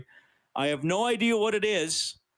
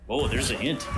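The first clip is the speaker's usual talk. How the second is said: usual speaking voice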